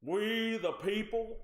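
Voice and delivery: put-on slow, grand old president voice; presidential address. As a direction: intone it like an old-time president. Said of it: "You sound like one of the old presidents".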